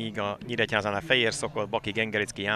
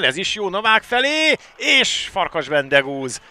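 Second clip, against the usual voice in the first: excited commentary; 8 semitones higher, 6 dB louder than usual. Real, not acted. Live goal chance call.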